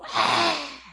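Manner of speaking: high, breath-rasped shriek; imitated horrible scream. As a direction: cry out like a spirit being cast out. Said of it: "com um grito horrível".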